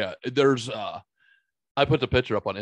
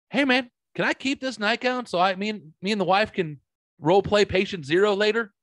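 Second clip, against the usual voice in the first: higher-pitched acted-out asking; pitched up, mock-innocent request; cheeky request; 8 semitones higher than usual. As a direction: play the eager patient asking a favor. Acting out cheeky request to nurse.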